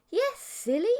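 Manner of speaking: high, sweet teasing voice; playful insect girlfriend. A narrator's reading. Mantis girl playfully scolds her stunned new boyfriend. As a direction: tease him like a giggly sweetheart.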